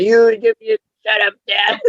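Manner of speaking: high, put-on child's retort; imitated annoyed kid. Daughter snapping back at teasing parent, imitated annoyance.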